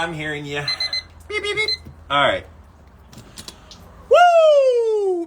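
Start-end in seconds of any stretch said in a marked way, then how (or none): 1.3-1.7 high "Beep, beep, beep" like a timer; imitated beeping. Ice bather mimics the timer beeping, high and chirpy. 4.1-5.3 very high, loud whoop. Real, not acted. Ice bather whoops out loud as the cold hits, very high. Exhilarated after ice bath.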